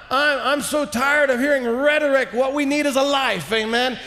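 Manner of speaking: normal talking voice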